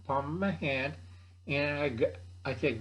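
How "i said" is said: ordinary delivery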